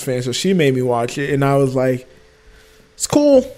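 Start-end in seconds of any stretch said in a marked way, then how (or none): 2.9-3.5 high, flippant brush-off; bored dismissal. Retold or imitated. Friend mocks his own shrug at The Matrix, high and flippant. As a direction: toss it away like you couldn't care less.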